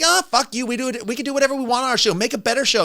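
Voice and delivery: imitating a high, loud, fast snippy comeback; mild mocking sass; mock sassy retort. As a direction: toss it off like a bratty host. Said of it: "and you're like".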